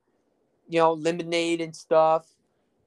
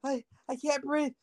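high, strained mock panic while choking; panicked retold cry; 10 semitones higher than usual. Teen retelling choking at dinner, high panicked squeal. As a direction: squeal it like food is stuck up your nose. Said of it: "And I'm just like".

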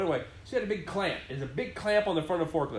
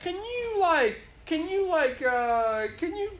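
mock hesitant boss; 10 semitones higher, slower than usual. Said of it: "They just said, like".

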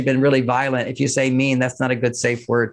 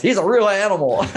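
laughing admiration; 5 semitones higher than usual. Real, not acted. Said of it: "I'm laughing thinking about".

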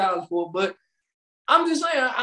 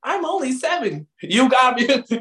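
ordinary delivery

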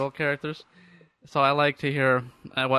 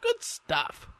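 much higher, soft falsetto echo; mock high; 11 semitones higher, 5 dB quieter than usual. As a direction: repeat it in a high squeak.

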